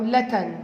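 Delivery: لتن with t replacing the b of لبن; sound substitution. Shown as a demonstration. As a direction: say لتن, swapping middle b for t. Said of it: "بدل يعني قال لتن".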